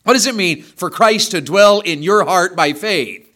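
normal talking voice

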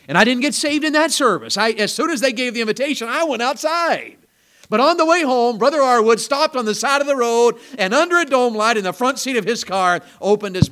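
ringing pulpit delivery; full-force preaching. Real, not acted. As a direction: preach it out to the back row. Preaching climax of testimony.

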